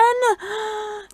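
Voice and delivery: sharp theatrical gasp; dramatic gasp. Acted. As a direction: gasp for effect. Dramatic build-up to stories.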